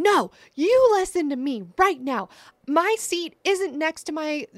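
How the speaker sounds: loud, high mock rant; angry passenger. Passenger refusing to sit, high angry mock rant. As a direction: play the furious passenger. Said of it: "he starts going".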